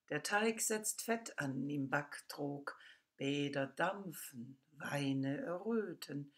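measured, emphatic poem reading with swinging pitch; poetry recitation. Acted. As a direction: recite the poem, punching key words and pausing between images. (ausdrucksvoller, betonter Gedichtvortrag)

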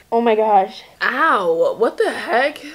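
pained ow from a brain freeze. Real, not acted. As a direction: wince through a brain freeze. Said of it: "Oh my God, I have a brain freeze".

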